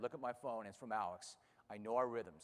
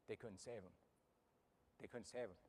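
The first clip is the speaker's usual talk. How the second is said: much softer, grave retelling; hushed bad news; 9 dB quieter than usual. [name] telling Jim the horse died, hushed and much softer. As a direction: let it fall nearly silent. Friend relays the horse's death.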